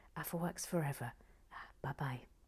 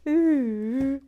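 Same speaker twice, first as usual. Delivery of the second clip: loud, high drawn-out "ooh"; delighted ooh; 8 semitones higher, 13 dB louder than usual. Real, not acted. Reacting to the wobbling Lego model, loud ooh. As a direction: let out a big, high ooh.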